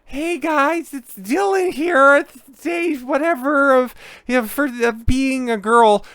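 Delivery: a cartoonish high falsetto mock vlog intro; mocking Dylan Mulvaney. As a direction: lilt it up high like a bubbly influencer greeting, mocking. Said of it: "more or less exactly what Dylan does".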